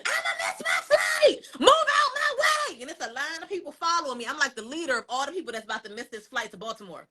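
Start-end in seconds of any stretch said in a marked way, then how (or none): 0.0-2.8 high, frantic shout; yelling while running. Retold or imitated. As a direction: yell it out as you sprint for the gate. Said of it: "I'm running and I'm yelling".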